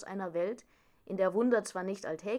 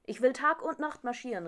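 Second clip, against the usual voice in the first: higher, louder eager declaration; determined exclamation; 5 semitones higher, 5 dB louder than usual. A narrator's reading. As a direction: call it out, keen and insistent. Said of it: "rief Kaspar". (eifriger Ausruf)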